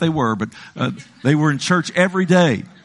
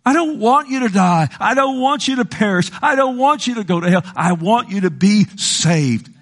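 pleading as God; 6 semitones higher than usual. Retold or imitated. God pleading with sinners to be saved, impassioned high plea. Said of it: "He says".

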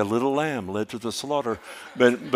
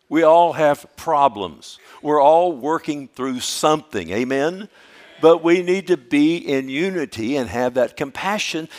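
impassioned pulpit preaching; full-force preaching; 4 semitones higher, 6 dB louder than usual. Acted. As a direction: drive it home to the congregation.

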